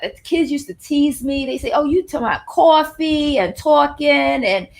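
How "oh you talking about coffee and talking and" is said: imitating a strong Long Island accent; mock Long Island accent on coffee, talking, father. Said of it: "really strong Long Island accent".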